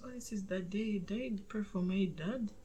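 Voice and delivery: hushed quoted words; whispering. Retold or imitated.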